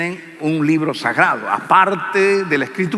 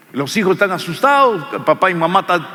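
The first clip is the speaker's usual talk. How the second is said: ordinary talk, nothing marked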